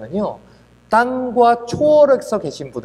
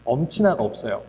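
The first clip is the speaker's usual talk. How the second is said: ordinary talk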